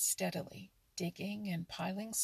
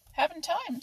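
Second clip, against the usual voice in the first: higher, louder brush-off; brisk refusal; 10 semitones higher, 9 dB louder than usual. Acted. Muskrat brushes off the otter's race, brisk and loud. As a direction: cut him short, too busy.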